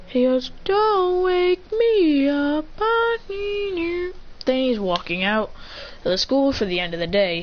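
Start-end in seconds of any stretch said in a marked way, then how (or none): 0.6-4.1 high, very slow drowsy voice; dreamy high slow mumble-plea; sleepy plea. Retold or imitated. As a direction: drag it out like half asleep. Character's line in retold story.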